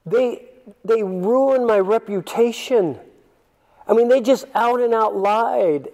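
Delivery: high, slow, wounded whine to the Lord; whining complaint. Retold or imitated. Listener complains to the Lord in a high, wounded whine. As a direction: protest like someone who feels wronged. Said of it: "going where I went when I read that verse".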